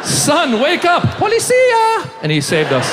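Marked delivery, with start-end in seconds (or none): 0.0-1.0 high, frantic father's call; urgent waking call. Acted. Frantic father wakes sleeping son. 1.2-2.1 very high shout of Policia; frantic cry. Acted. Frantic father's cry on plane.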